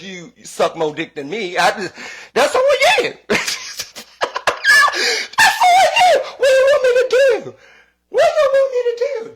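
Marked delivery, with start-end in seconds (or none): 1.6-3.1 high, loud, laughing through the words; shrieking with laughter. Real, not acted. 3.2-5.3 screeching cackle; high shrieking laugh. Real, not acted. 5.4-9.3 high, loud, laughing through the words; shrieking with laughter. Real, not acted.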